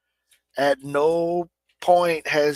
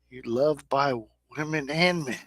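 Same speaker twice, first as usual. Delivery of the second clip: usual speaking voice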